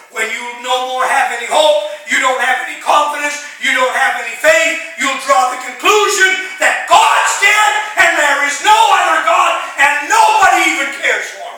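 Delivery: high, loud preaching cry; full-force preaching. Acted. Preacher warns the church, loud and high. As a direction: preach it hard to the back row.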